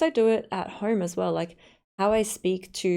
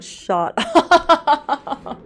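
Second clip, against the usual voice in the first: high, loud burst of laughter; words break up into loud laughter; real laughing outburst; 8 semitones higher, 10 dB louder than usual. Real, not acted. Streamer cracks up at Central Station remark, laughing.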